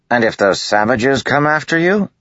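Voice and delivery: high, loud challenge; demanding. A narrator's reading. Matal demanding an answer from Kaladin, loud challenge. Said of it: "Matal demanded".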